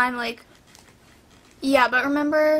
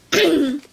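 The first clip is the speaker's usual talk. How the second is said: harsh throat clearing; coughing fit; 5 dB louder than usual. Real, not acted. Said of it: "I'm sorry I'm coughing so much".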